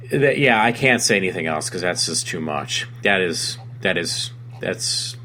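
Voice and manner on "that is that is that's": halting false starts, trailing lower; stuttering, lost for words. Real, not acted. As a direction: keep restarting, too stunned to finish. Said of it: "I'm stuttering on my words".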